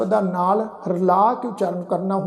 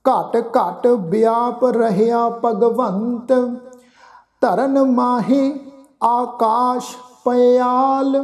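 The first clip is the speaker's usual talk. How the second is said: slow, measured recitation of sacred verse; slow scripture recitation; 3 semitones higher, much slower than usual. Acted. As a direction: lay each phrase down slowly with pauses. Recites gurbani verse slowly.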